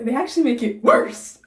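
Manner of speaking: loud, exasperated emphasis on actually. Real, not acted. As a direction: stress actually with outrage. Exasperated emphasis on worse result.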